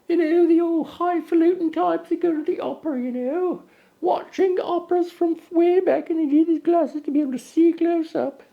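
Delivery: posh opera-goer voice. Acted. Host mocking opera-goers in a high snooty voice. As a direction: play a highfalutin opera snob. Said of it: "Daddy, what happened to your voice?".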